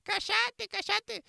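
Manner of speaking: high, sharp mock scolding; crowd hushing him. Preacher imitating the crowd silencing the beggar, high and sharp. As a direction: snap at him to shut up. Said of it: "le decían".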